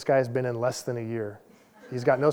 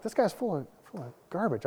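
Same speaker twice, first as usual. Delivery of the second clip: mock worked-up, higher but kept under the breath; 4 semitones higher, 8 dB quieter than usual. Passenger silently judging a boastful young Marine, retold worked-up grumble. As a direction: grumble it high and softly, as retold indignation. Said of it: "I'm getting worked up".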